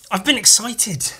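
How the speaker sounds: ordinary delivery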